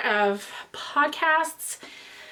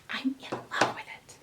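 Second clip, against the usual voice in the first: whispering; 9 dB quieter, faster than usual. Real, not acted. Whispered delight over a gift.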